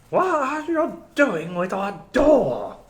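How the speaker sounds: put-on high, slow old woman's voice; old tavern woman. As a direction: voice the suspicious old crone at the door.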